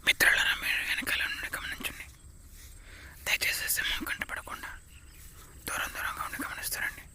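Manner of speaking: urgent hushed whisper. Acted. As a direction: whisper so the lion won't hear. Near lion, warning group.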